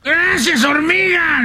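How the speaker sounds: high grateful donkey voice; donkey thanking the ant, high; donkey's thanks. Acted. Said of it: "le dijo el burro".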